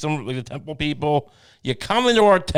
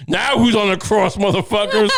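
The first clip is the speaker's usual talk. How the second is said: louder, higher gloating yell; mock triumphant taunt; 5 semitones higher, 4 dB louder than usual. Jesus taunting his executioners, gloating loud. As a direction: crow it like a cartoon victor.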